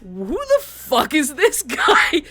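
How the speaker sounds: slow, loud, disbelieving, breaking into a laugh; laughing at a total stranger's nerve; high, slow, amused outrage; baffled laughing. Retold or imitated. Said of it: "so, like".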